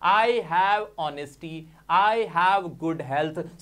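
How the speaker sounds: "I have honesty, I have good health" said very slowly; deliberate model sentences, very slow; slow demo. Shown as a demonstration. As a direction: spell it out slowly for students. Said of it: "Simply बोलो".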